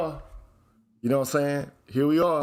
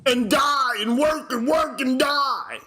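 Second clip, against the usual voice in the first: high, slow, hammed-up chant; drawn-out mocking holler; mock declaiming; 7 semitones higher, slower than usual. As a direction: stretch each word out high in mockery. Mocks demands placed on men.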